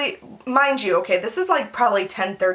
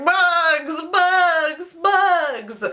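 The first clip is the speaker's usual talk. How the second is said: high, drawn-out wail on each word; hammed-up bawling, word by word; mock crying; 7 semitones higher, much slower than usual. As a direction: imitate helpless tearful wailing. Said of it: "I just start crying again".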